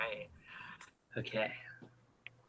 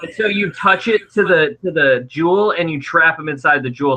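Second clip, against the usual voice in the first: ordinary talk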